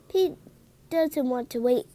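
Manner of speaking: ordinary delivery, nothing marked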